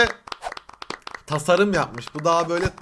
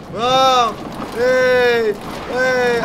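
loud, higher whoops; loud excited whoops; cheering; 5 semitones higher, 5 dB louder, much slower than usual. Real, not acted. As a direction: hype up the ride. Cheering the ride.

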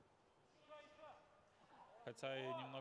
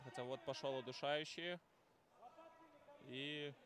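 excited commentator; 3 semitones higher, 5 dB louder than usual. Real, not acted. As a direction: announce the choke with a jolt. Commentator calling a choke.

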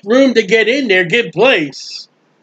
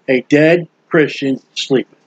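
quoting Paul; 7 semitones lower than usual. Retold or imitated. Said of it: "Paul says".

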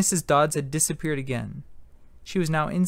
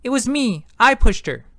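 high, loud, desperate blurt; panicked confession; 8 semitones higher, 9 dB louder than usual. A narrator's reading. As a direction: shout it out to take the blame.

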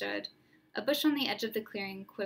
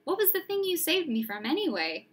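put-on young boy; 7 semitones higher, 4 dB louder than usual. Harry asking, boy voice.

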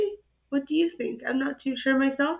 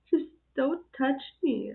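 usual speaking voice

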